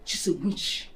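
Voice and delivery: normal talking voice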